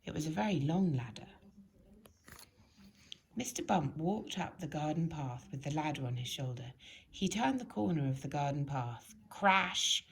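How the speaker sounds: ordinary delivery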